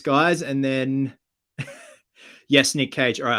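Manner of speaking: ordinary delivery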